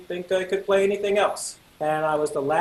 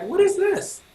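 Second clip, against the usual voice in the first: high-pitched mock indignation; acted-out baffled protest; offended disbelief; 13 semitones higher than usual. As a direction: squeak it out, affronted. Offended at director's hesitation.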